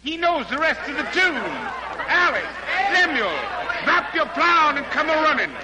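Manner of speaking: the fiddler calls his family to hear the stranger, excited; calling the family. Acted. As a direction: summon the whole household, thrilled.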